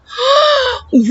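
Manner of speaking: sharp high gasp of shock. Acted. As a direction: gasp in amazement at the window. Boy sees garden through window.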